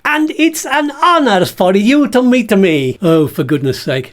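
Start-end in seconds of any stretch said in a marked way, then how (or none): 0.0-3.0 high, smug puppet voice; Bert voice. Acted. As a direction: boast as a vain little bag. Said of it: "This is Bert the Bag".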